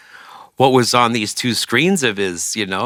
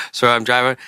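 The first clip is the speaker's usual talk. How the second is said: usual speaking voice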